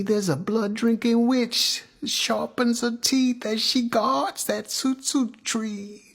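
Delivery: high, drawn-out eerie warning; ominous storytelling. Acted. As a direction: warn of the witch, held high.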